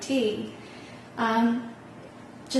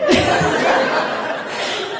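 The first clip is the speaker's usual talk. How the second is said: high breathy laugh, louder; real laugh; 11 semitones higher, 8 dB louder than usual. Real, not acted. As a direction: laugh freely at the punchline. Laughs at the retold story.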